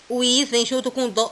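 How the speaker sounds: usual speaking voice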